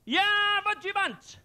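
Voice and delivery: high, hammed-up German accent; mock German accent. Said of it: "The German chap plucks out".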